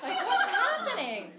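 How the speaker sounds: high, loud laughing disbelief; high laughing outrage; exasperated. Retold or imitated. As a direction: throw up your hands. Confronting roommate about naked photos.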